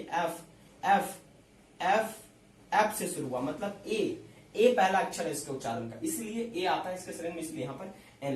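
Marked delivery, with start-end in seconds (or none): 0.0-2.2 एफ repeated separately as "eff", with pauses; pronunciation demo. Shown as a demonstration. Teacher showing how the letter is pronounced in Hindi, pronunciation demo. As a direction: isolate each एफ so the opening vowel is heard. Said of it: "इसका हिंदी उच्चारण देखिए".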